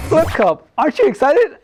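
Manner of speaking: ordinary talk, nothing marked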